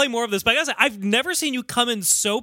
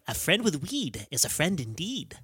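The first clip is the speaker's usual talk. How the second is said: softer put-on leprechaun voice; movie leprechaun, voice held down; Warwick Davis; 2 semitones lower, 7 dB quieter than usual. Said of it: "I was hearing more Warwick Davis from".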